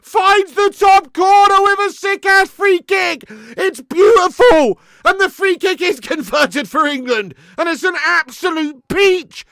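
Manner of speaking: high, slow, shouted commentator call; hammed-up football commentary roar; mock goal commentary. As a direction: bellow it like a commentator on a screamer.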